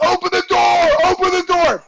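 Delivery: high, urgent holler to the driver; retold yell. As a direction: mock-desperate shout to be let in. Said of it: "I yell to the bus driver".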